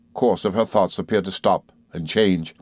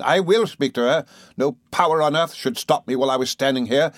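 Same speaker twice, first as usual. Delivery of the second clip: furious accusation; 6 semitones higher than usual. Acted. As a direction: hurl it at the mother like a curse. Rosa attacking grieving mother.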